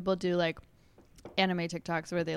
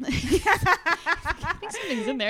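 slow, high, giggling through the words; laughing boyfriend quote; 9 semitones higher, much slower than usual. Retold or imitated. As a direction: act him out while laughing. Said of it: "and he goes".

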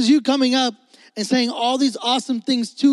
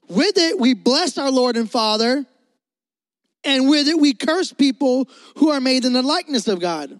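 scripture reading; 4 semitones higher than usual. Acted. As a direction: read the verse with weight. Reads scripture aloud.

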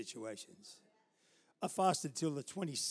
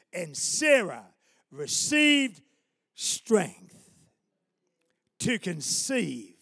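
loud, slow, drawn-out declamation with big pauses; preaching scripture; 3 semitones higher, 11 dB louder, much slower than usual. Acted. Preacher proclaiming Sarah's promise to the congregation, slow loud preaching.

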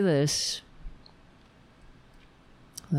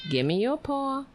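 much higher pet-talk voice; high coaxing; 7 semitones higher than usual. Shown as a demonstration. Owner tries saying it like that to the dog, high coaxing. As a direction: lift it high to coax the dog. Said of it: "maybe I have to say it like that".

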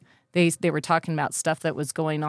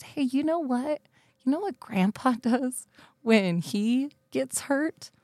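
put-on higher, coaxing voice to a child; gentle parent; 7 semitones higher, 3 dB quieter than usual. Parent retelling talk to hurt young son, high gentle coaxing. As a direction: coax an upset little one.

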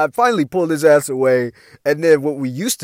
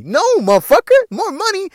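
high, loud incredulous cry; can't believe his ears; outraged disbelief; 5 dB louder than usual. Retold or imitated. To broke friend wanting more money, high outraged disbelief.

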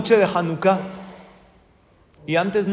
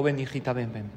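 soft, low coaxing; tender father; 4 semitones lower, 7 dB quieter than usual. Retold or imitated. Father softly coaxing his upset little daughter. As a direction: gently coax a crying child closer.

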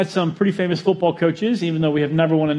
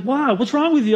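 higher concerned wife voice; wife alarmed; 8 semitones higher than usual. Retold or imitated. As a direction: voice the startled wife. Rachel's like.

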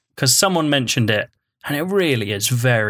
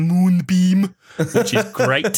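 Werner Herzog voice; 5 semitones higher, slower than usual. Retold or imitated. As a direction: savour the word like a solemn German filmmaker. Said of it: "I read the first page of the B F G out in it".

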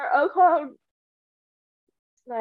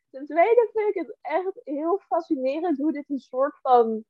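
ordinary talk, nothing marked

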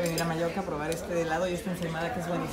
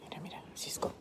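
soft whisper about the bird; 10 dB quieter than usual. Real, not acted. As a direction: whisper so the parrot stays calm. Showing the parrot on camera.

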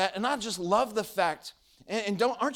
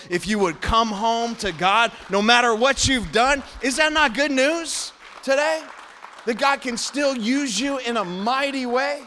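louder, rising, fervent preacher's call; preaching at full force; 3 semitones higher, 5 dB louder than usual. Real, not acted. Preacher urging the congregation to come home, fervently. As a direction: lift the room with the good news. Preacher's climactic good news.

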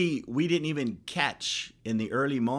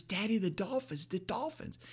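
high, soft imitation of an eager child; excited daughter; 8 semitones higher, 7 dB quieter than usual. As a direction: mimic a thrilled child telling Daddy, high but hushed.

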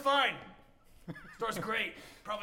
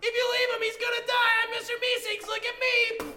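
higher, louder eager shouted warning; high cartoon helper shouting; Mister Meeseeks; 11 semitones higher, 8 dB louder than usual. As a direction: warn him with bright urgency. Meeseeks voice after attack.

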